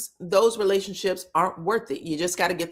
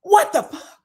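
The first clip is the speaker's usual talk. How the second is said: loud, high outburst; retold scream; 10 semitones higher, 7 dB louder than usual. Recapper replaying Ken's reaction to the accusation, screaming out. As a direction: explode in mock outrage. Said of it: "Ken screams out".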